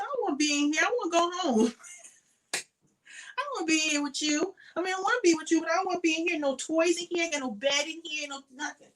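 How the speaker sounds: high whiny pretend dog voice; voicing the dog. Owner voicing her dog, whining to go home, high and whiny. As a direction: speak for the dog like a sulky child. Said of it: "She said".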